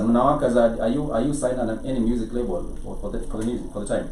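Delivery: ordinary talk, nothing marked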